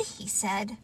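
plain reading voice

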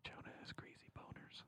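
muffled whisper behind the mic; whisper behind covered mouth. Real, not acted. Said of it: "My mouth is covered by the microphone".

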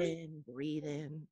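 soft, slow, drawn-out; hushed pretend guru pace; mock tantric calm. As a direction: imitate slow meditative breathing instructions. Mimicking tantric breathing.

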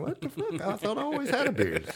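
high-pitched mock disbelief; incredulous. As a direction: exclaim it in puzzled disbelief, half laughing. Retelling his own startled reply.

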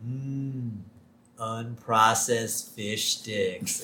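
slow, savouring drool over food; Homer. Retold or imitated. Host as Homer eyeing swimming fish, dreamy and drooling. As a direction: draw it out hungrily, very slowly.

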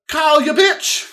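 high, loud furious cry; outraged yell. Retold or imitated. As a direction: yell it at the screen. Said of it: "And you're like".

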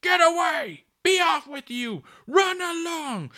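very loud, high-pitched furious yelling; angry shout. A narrator's reading. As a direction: drive intruders off at full volume. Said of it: "shouted angrily".

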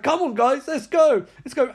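acted call to move; urgent rallying. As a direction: hurry the squad along. Officer rallying the others.

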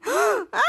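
high-pitched "ah" cry; acted shout. Group watches the bottle fumbled, high acted cry. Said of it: "all shouting".

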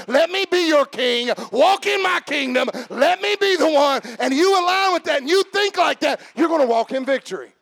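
loud, high, fervent sermon build; preaching at full force. Acted. Preacher voicing God to the church, shouting fervently.

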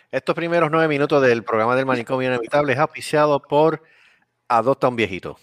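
cheesy charity ad voice; mock commercial read. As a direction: sell it like a TV charity appeal.

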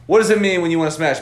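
exasperated. Retold or imitated. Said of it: "looks at Bobby Nasty and goes".

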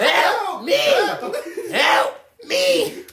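high, loud, laughing fake plea; hammed-up kidnapped victim yelling through laughter; mock cry for help. Friend jokes he got kidnapped, laughing mock cry for help.

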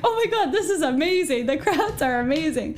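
high, gushing mock-thrilled voice; imitating excitement. Retelling her running partner's race-day thrill, gushing. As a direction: parody her friend's over-the-top glee. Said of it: "You kept saying to me".

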